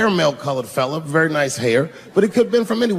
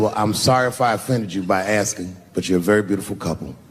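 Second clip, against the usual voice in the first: mock-polite apology; 9 semitones lower than usual. Acted polite apology before the jab.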